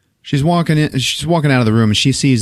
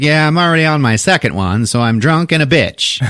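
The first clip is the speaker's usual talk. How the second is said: tipsy, brash; 7 semitones higher than usual. Retold or imitated. Tipsy older woman boasting.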